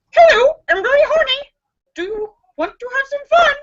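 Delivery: high, fast squawking parrot voice; squawky parrot. Acted. Female parrot squawking a come-on.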